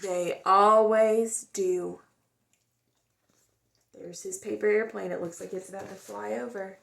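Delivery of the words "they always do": expressive storybook read-aloud; gentle, lively storytime voice; reading to children. A narrator's reading. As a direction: tell it like a picture book for kids. Reads picture book aloud.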